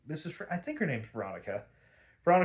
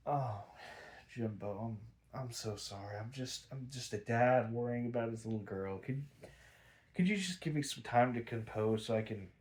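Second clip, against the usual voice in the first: softer, lower mock-tearful father, laying it on; hammed-up sorry act, quiet and lower; fake heartbroken apology; 3 semitones lower, 7 dB quieter than usual. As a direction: colonel faking grief to Jim after the coffee spill, mock-pitiful. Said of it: "Oscar-worthy performance right here".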